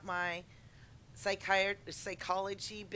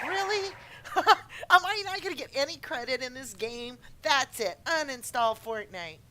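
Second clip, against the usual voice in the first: higher, louder mock outrage; mock indignation; 4 semitones higher, 7 dB louder than usual. Retelling arguing over a kill, mock indignant. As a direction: demand credit, hammed-up. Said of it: "I'm like".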